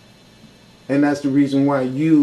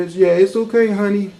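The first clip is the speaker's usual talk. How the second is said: sweet-talking hustler; 5 semitones higher than usual. Pretend. To a crying churchgoer while pocketing their twenty, mock soothing. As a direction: coo at them like a con man soothing a mark. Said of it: "Because I'll be telling you".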